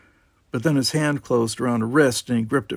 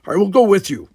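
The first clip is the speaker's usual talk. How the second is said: loud, higher sharp snap; snapped; 9 semitones higher, 9 dB louder than usual. Acted. Worf snapping at Dini, loud and sharp. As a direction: cut in hard. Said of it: "snapped Worf".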